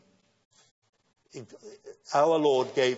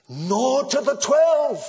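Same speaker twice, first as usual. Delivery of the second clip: "twelve" much higher, louder and slow; preaching climax; 12 semitones higher, 9 dB louder, slower than usual. Acted. Preacher building the list to its peak, loud and slow. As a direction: ring it out to the back row.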